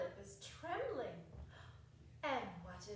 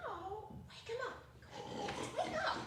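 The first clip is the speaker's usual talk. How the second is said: urgent wake-up call; 10 semitones higher, faster than usual. Acted. Voice urging sleeper awake.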